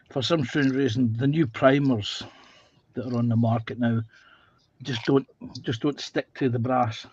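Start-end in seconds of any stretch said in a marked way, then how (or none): 4.8-7.1 talking around a paintbrush in mouth; words muffled by a paintbrush between teeth. Real, not acted. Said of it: "got a paintbrush in my mouth".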